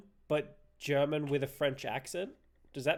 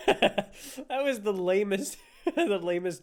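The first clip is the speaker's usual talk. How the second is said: laughing; 5 semitones higher, 5 dB louder, slower than usual. Real, not acted. Mocking the in-game German.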